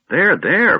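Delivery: high, flustered comforting; an awkward old wizard patting the kender; embarrassed old man. Acted.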